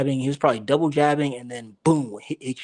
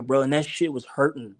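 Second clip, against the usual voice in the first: normal talking voice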